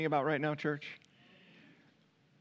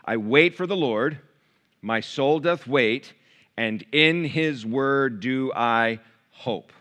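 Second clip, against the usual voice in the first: slow, louder solemn reading of the verse; scripture reading; 4 dB louder, slower than usual. Acted. Preacher reading the centerpiece verse to church, slow and solemn. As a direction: read it out slowly with weight.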